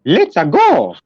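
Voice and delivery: high, loud game-hero cheer; Mario. Shown as a demonstration. Entertainer shows off voices to interviewer, high cartoon cheer. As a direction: pitch it way up, punchy cartoon shout. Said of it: "Like Mario".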